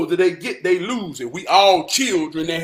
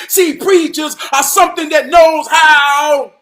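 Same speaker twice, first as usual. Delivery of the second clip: loud, emphatic preacher delivery; impassioned preaching; 7 semitones higher, 10 dB louder than usual. Real, not acted. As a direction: proclaim it to a congregation. Preaches on what preachers are.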